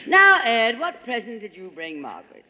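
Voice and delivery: normal talking voice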